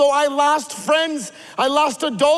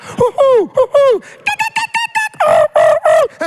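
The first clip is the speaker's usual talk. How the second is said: loud, high hooting and chirping; kids' bird calls; 10 semitones higher, 7 dB louder than usual. Retold or imitated. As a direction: hoot and chirp like a jungle of birds. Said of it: "so they start making bird calls".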